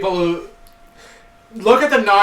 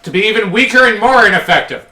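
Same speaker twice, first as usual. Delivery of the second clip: mocking, faster and higher; sarcastic jab; 5 semitones higher, 7 dB louder, faster than usual. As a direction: toss it off as a snide joke. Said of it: "I'm joking".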